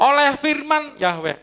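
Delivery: slow, high, ringing reading of the verse; solemn scripture reading. Acted. Preacher reciting a psalm verse to his congregation, slow and ringing. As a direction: proclaim the verse slowly to the congregation.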